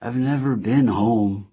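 slow, high, drugged and woozy; faint drunken wonder; dazed drunk. Acted. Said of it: "riding high on whatever pain meds".

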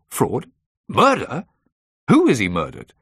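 loud, high incredulous questions; shocked demand. A narrator's reading. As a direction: leap up in shock, then demand loudly. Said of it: "Patrick demanded".

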